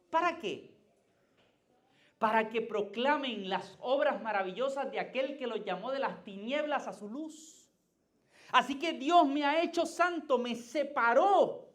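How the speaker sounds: loud, high, rising sermon climb; impassioned pulpit crescendo; full-force preaching. Acted.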